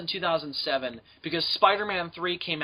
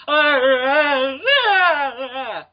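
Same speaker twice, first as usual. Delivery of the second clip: high, loud hammed-up wailing sobs; mock sobbing; 9 semitones higher, 7 dB louder, much slower than usual. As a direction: wail like a blubbering kid, over the top. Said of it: "I'm like".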